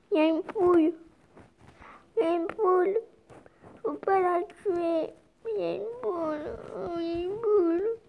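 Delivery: high, put-on cartoon voice; silly character voice. Pointing out a chicken in the game, high cartoon voice. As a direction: squeak out the chicken news like a kid.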